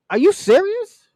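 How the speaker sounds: high-pitched, exasperated disbelief; incredulous. Real, not acted. Reacting to the president offering rioters money, incredulous. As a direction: throw it out as an appalled question.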